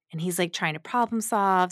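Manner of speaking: ordinary talk, nothing marked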